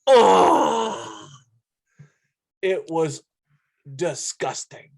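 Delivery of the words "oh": a loud, high pained "Oh!" like a whole hotel wincing; a mock anguished group cry; retold crowd groan. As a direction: imitate everyone groaning at the gruesome hit at once. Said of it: "all you heard from floor one to floor seven was".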